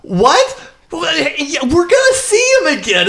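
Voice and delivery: high-pitched, incredulous retort; laughingly baffled, voice jumping up. Retold or imitated. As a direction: react as if it were absurd. Acting out their baffled reply.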